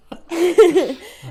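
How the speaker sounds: high burst of laughter; real laugh. Real, not acted. Laughing at the co-host's accent, a real high laugh. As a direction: laugh out loud at the co-host. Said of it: "laughing at pronunciation".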